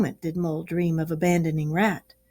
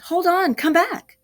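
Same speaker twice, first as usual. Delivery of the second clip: high, eager call after a friend; excited plea to stop; joyful calling; 10 semitones higher than usual. A narrator's reading. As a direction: call him back, bursting with excitement. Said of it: "full of joyful excitement".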